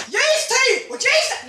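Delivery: high-pitched Northern Irish scolding; mock Northern Irish teacher breaking up a fight. Teacher scolding two pupils, high Northern Irish accent. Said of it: "she was from Northern Ireland".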